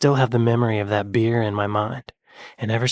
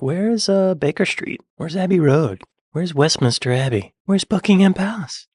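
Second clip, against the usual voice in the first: lightly voiced lost tourist, pitched up; mock clueless tourist; 6 semitones higher than usual. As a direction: play at being the pestering tourist. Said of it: "Be like".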